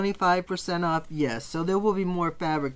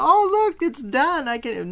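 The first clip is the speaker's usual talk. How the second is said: high, loud burst of excitement; imitating your own eager outburst, much higher and louder; excited self-quote; 9 semitones higher, 7 dB louder than usual. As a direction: blurt it out as if thrilled to be finished. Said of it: "but I got excited".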